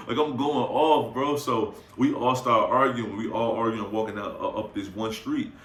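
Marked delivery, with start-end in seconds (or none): none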